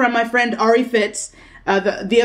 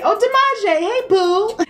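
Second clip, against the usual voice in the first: excited greeting; 10 semitones higher, slower than usual. Real, not acted. Greeting a commenter fondly.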